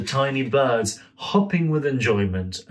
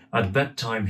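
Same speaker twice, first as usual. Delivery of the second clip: usual speaking voice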